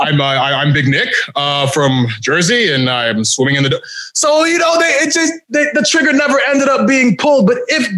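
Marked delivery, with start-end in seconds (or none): 0.0-3.8 low, halting, half-hearted delivery; deep, deflated mumble with stumbles; uncommitted promo. Retold or imitated. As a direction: imitate a wrestler who won't commit to his character. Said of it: "exactly how you were cutting the promo".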